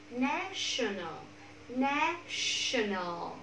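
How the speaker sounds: very slow, drawn-out model word; slow-motion pronunciation demo; syllable by syllable. Shown as a demonstration. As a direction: stretch each syllable for learners to copy. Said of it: "ba âm tiết".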